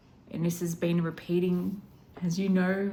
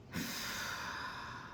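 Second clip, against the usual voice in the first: long unvoiced emotional sigh; deep breathy sigh while moved; 11 dB quieter than usual. Real, not acted. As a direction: breathe out the feeling. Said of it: "And I'm getting emotional".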